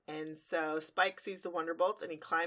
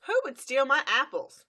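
higher and louder, put-out complaint; indignant farm pony; 8 semitones higher, 9 dB louder than usual. Retold or imitated. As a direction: demand to know who took them. Applejack voiced, indignant.

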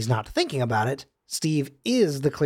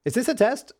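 naive question; 8 semitones higher than usual. Retold or imitated. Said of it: "Steve then goes".

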